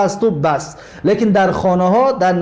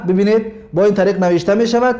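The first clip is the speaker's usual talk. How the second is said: ordinary delivery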